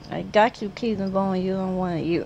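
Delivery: normal talking voice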